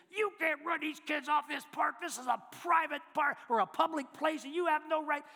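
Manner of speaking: loud, high-pitched irate tirade; angry sputtering. Retold or imitated. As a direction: yell it like an irate man right in someone's face. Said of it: "He gets spitting and sputtering, red in the face".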